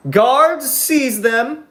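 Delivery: high, imperious royal command; evil queen. Pretend. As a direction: summon the guards like a tyrant queen. Cult leader commanding guards.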